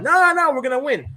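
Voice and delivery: high, loud mocking fan voice; squeaky protest, no no no; mock insistent fans. Fans' reply retold.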